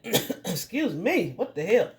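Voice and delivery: coughing, slow and strained. Real, not acted. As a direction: cough it out, then excuse yourself. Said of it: "I'm choking".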